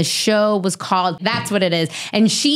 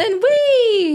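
sudden high squeal of delight; playful squeal. Real, not acted. Guest teasing the host, high playful squeal.

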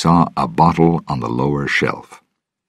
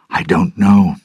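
ordinary delivery, nothing marked

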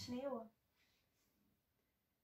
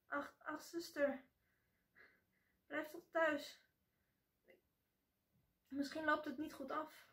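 worried pleading; 4 semitones higher, 4 dB quieter than usual. A narrator's reading.

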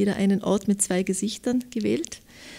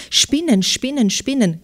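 higher, louder cry with each word falling; narrated shriek; 4 semitones higher, 8 dB louder than usual. A narrator's reading. Someone at the window spots spiders, narrated shriek. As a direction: call out the alarm as a storyteller's shriek. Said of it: "kreischte es".

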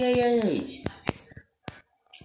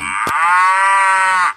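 sharp loud startled gasp; 4 semitones higher, 7 dB louder than usual. Real, not acted. Kid startled by the game, loud gasp. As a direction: suck in air in sudden fright.

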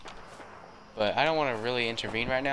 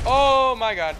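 high, loud panicked cry; 13 semitones higher, 7 dB louder, much slower than usual. Real, not acted. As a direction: cry out as it charges. Deathclaw charges at him.

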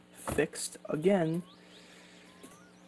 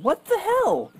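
much higher, louder what the hell; yelling at a battery that won't go in; exasperated yell; 8 dB louder, slower than usual. Real, not acted. Frustrated driver yells at his broken car.

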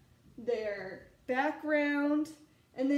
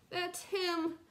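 higher, lightly voiced scared child; frightened cub; 7 semitones higher than usual. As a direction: point out the bully, a touch afraid. Frightened cub points out bully.

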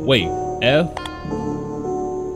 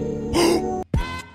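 gasp; 14 semitones higher, 6 dB quieter than usual. Real, not acted. Startled by solving puzzle.

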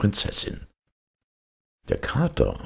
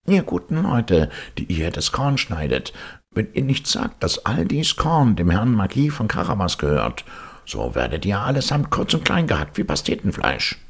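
higher, pushed cat voice making a threat; threatening cat; 7 semitones higher than usual. Acted. Cat threatens the reapers in a higher, pushed voice. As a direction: scare the reapers into obeying. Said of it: "sagte zu ihnen".

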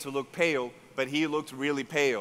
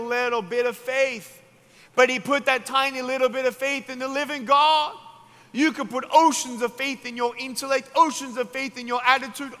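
high, loud pulpit preaching that builds on each phrase; fiery preaching; 7 semitones higher, 4 dB louder than usual. Acted. Preacher urging the crowd about faith, fiery and loud. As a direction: drive the point home to the congregation.

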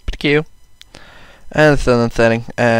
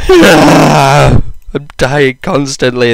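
mock whining; 3 semitones higher, 6 dB louder, much slower than usual. Real, not acted. Keeps dying in the game.

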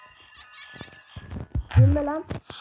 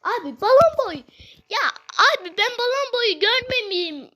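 high, loud complaint; frustrated yelp; exasperated outburst; 8 semitones higher, 4 dB louder than usual. Real, not acted. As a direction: cry out in frustration. Blaming Balloon Boy for death.

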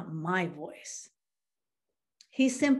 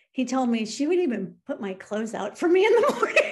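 laughing through the words, quick and louder; 5 semitones higher, 6 dB louder, faster than usual. Real, not acted. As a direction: chuckle fondly while telling it. Fond laugh retelling wife's care.

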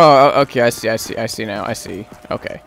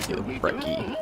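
whisper; 19 dB quieter, faster than usual. Real, not acted. Hushed to himself.

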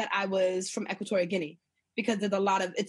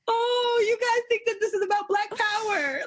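touched realisation; 11 semitones higher than usual. Retold or imitated. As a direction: pitch it way up, as if pitying them. Voicing her amused realisation back then.

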